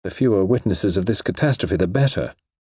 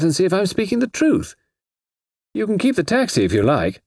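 lightly voiced suspect, higher pitch in appeals; persuading suspect; 4 semitones higher than usual. A narrator's reading. Suspect to inspector as a last attempt, lightly acted persuasion. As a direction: make a raised-pitch last attempt to convince.

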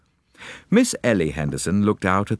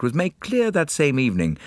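ordinary delivery, nothing marked

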